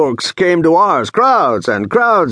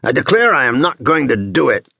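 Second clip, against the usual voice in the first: slightly raised, huffy refusal; mildly worked-up, higher-pitched protest; excited; 4 semitones higher than usual. A narrator's reading. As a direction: declare it grandly for the onlookers. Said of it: "becomes even more excited".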